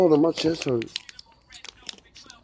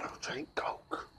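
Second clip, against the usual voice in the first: breathy whisper; 22 dB quieter, slower than usual. Real, not acted. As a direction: say it under your breath. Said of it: "whispered aside".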